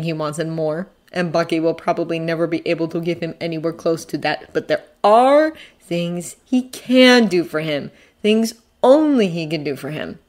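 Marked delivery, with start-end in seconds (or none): 4.5-7.9 "are" stretched out, "can" punched; slow, emphatic "are" and "can"; stress on ARE and CAN. A narrator's reading. 8.2-10.2 "only" lifted, then falling; stress on ONLY. A narrator's reading. Narrator emphasizes only.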